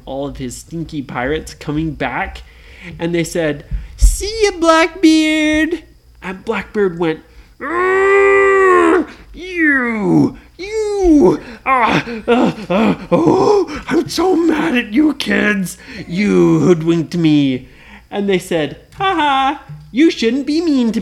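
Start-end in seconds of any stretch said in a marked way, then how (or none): 4.0-5.8 high, loud mocking goodbye; teasing farewell. Kids teasing Blackbeard as they sail off, high and cheeky. 7.6-17.7 high, loud sputtering pirate fury; hammed-up rage. Blackbeard raging at the escaping kids, mock sputtering fury.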